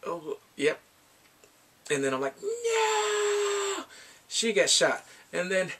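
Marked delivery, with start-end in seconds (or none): 2.4-3.8 long, high wailing no; drawn-out theatrical scream of dismay; mock horrified wail. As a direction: cry out in hammed-up horror. Acted horror at character getting shot.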